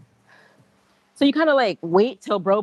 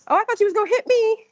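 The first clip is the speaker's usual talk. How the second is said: much higher, cutesy imitation of a guy flirting; cute mock man; 12 semitones higher than usual. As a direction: mimic him acting cute and coy. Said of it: "But he said it kinda cute".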